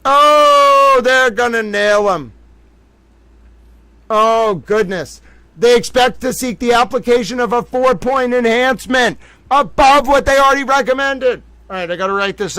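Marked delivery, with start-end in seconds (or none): 0.0-2.3 much higher, louder giddy exclaim; can barely contain the glee; gleeful. Real, not acted. Repeats in gleeful squeal. 4.1-5.2 slow, higher, louder sigh of glee; delighted. Real, not acted. Breathless delight. 5.6-11.4 higher, louder eager read-out; can't wait to share it; excited reading. Real, not acted. Reads document excitedly.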